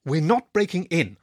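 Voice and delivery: testy, insistent denial; snapped. A narrator's reading. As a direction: snap it back at a friend. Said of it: "I snapped".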